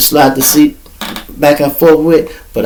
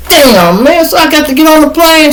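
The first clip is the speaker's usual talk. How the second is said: dismayed whine; 4 dB louder than usual. Retold or imitated. Dismayed at having to fly.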